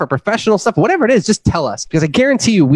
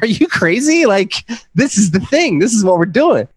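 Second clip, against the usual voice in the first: high-pitched, incredulous comeback; incredulous retort; 4 semitones higher than usual. Retold or imitated. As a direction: act out an exasperated retort to a doubter. Acting out retort to skeptical spouse.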